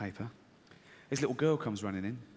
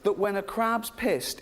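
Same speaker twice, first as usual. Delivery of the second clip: ordinary delivery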